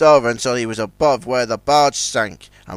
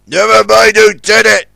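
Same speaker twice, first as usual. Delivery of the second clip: snapped; 5 semitones higher, 8 dB louder than usual. A narrator's reading. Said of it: "snapped Big Mac".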